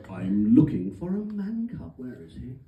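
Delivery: put-on villainous English accent; menacing tiger with an English accent; Shere Khan. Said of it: "English accent".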